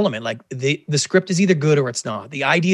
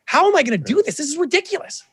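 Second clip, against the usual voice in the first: higher-pitched, half-mocking anxious inner voice; retold panic; 11 semitones higher than usual. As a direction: replay your own panic, pitch climbing. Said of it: "a panic of like".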